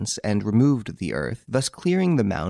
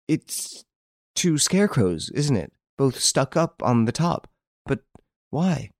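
higher, uneasy questioning; shuddering fear; 6 semitones higher than usual. A narrator's reading. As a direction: sound a little spooked, searching. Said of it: "she shuddered and said".

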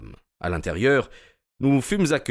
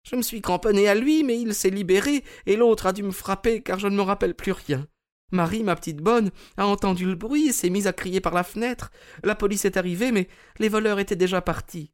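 put-on high, sad old lady voice; a frail lady, smiling sadly; Mrs Maberley; 13 semitones higher than usual. A narrator's reading. Mme Maberley à son conseiller Holmes, voix aiguë et triste.

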